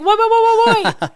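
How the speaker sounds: loud high whoa, hammed-up alarm; mock panicked stop. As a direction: pull the brakes in mock alarm. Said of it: "And I was like".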